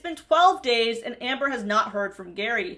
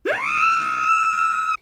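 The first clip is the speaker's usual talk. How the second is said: mock shocked gasp; 4 dB louder than usual. Driver's imagined reaction.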